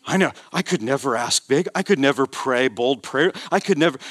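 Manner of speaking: put-on meek, self-effacing voice; imitated humble reluctance, voice held back; mock humility. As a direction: act out false modesty, demurring piously. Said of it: "False humility says".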